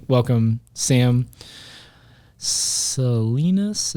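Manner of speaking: Salinas drawn out very slowly, each syllable spaced; careful name pronunciation. Shown as a demonstration. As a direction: welcome Sam, then Salinas stretched out slowly like an announcer. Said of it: "Is that how you say your last name?".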